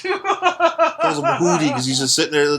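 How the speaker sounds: ordinary talk, nothing marked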